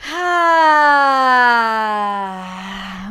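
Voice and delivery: long loud audible exhale; big releasing sigh. Shown as a demonstration. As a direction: sigh it all out. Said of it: "big sigh".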